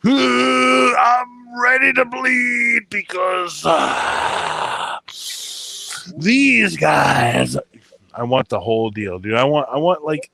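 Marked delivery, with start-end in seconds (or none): 0.1-7.6 much higher, loud, slow hype shout; wrestling promo. Acted. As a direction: roar it like a wrestler entrance.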